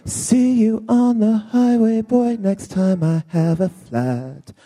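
ordinary delivery

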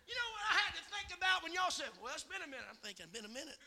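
softly thinking aloud; quiet comic aside. Real, not acted. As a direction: drop away into a private musing. Quiet aside recalling his own thought.

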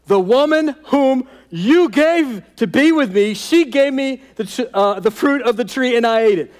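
high, loud whining excuse; whiny Adam. Acted. Preacher voicing Adam passing the blame. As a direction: plead like a caught little boy. Adam's excuse voiced in sermon.

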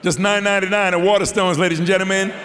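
loud, high hard-sell plug; salesman pitch. Pretend. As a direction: hawk the book like a market trader. Jokey book plug to audience.

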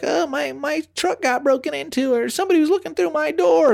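high-pitched whiny complaint; mock worried neighbors. Neighbors posting on the app about break-ins, high mock-worried voice. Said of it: "people's like".

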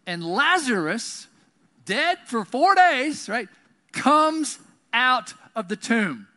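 loud, high, very slow proclamation; triumphant preaching. Real, not acted. Preacher announcing that the dead man comes out, triumphant proclamation. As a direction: proclaim each phrase with big pauses. Climax of the miracle, preached loudly.

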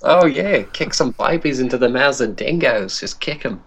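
high, hammed-up Aussie voice; mock Australian accent. British friend egging on the baby-kicking joke, mock Australian accent. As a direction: put on cartoonish Australian vowels. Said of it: "you said it in an Australian accent".

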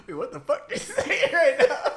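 high-pitched retold bafflement through laughter; incredulous quote breaking into laughs; laughing mock disbelief. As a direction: crack up while quoting their confusion. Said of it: "And then they were like".

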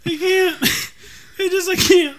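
laughing. Real, not acted. Friend laughing too hard.

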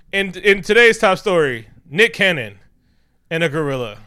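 high, loud top-story announcement; mock news anchor. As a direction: put on a big news-anchor delivery.

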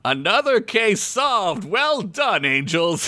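high, drawn-out theatrical proclamation; over-dramatic. A narrator's reading. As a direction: proclaim it slowly with exaggerated drama. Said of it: "Gary gasped over dramatically".

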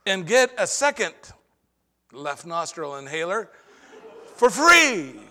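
mocking the commercial's free-offer line; mock ad announcer. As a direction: sell it like an over-the-top infomercial. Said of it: "You can hear it coming".

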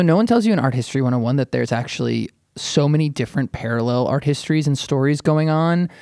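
ordinary delivery

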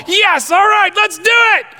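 very high excited shout, hammed-up; over-excited convert. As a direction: shout it like an overeager new believer. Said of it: "and your excitement".

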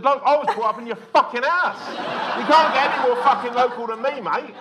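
high, loud laughing protest; mock outrage. Son protesting to his dad over local comedians, laughing loud. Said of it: "I said".

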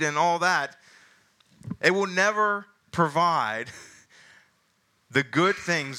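slow, high, deliberate preaching build; emphatic preaching. Acted. Pastor warning his congregation about false masters, slow emphatic preaching. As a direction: lean on each word to drive the point home.

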